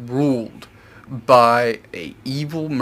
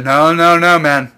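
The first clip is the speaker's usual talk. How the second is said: a bit louder, urgent pleading; Coby panicking; 4 semitones higher, 6 dB louder than usual. Retold or imitated. Coby warning Luffy about Zoro, louder, urgent alarm. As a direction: warn him off, alarmed. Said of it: "Kobe's like".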